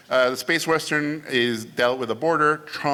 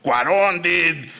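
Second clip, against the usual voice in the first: big, slow, emphatic mentor voice; hammed-up impression of a filmmaker mentor; Guillermo del Toro impression; 5 semitones higher, slower than usual. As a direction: draw the words out, higher and booming at the peaks. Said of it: "this is my Guillermo".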